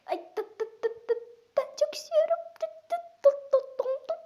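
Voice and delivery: teeth-chattering high squeaks; shivering. Real, not acted. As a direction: shiver through each tıp. Shivering in cold water.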